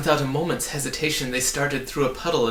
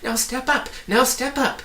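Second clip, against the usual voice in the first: much higher and louder call; 12 semitones higher, 5 dB louder than usual. A narrator's reading. Hidden voice guiding the travellers, calling out. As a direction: call them toward you. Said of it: "it recommended".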